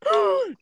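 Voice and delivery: sharp high gasp; high theatrical gasp. Acted. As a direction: gasp in mock shock. Mock gasp at joke.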